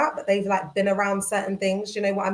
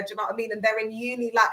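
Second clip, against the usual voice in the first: normal talking voice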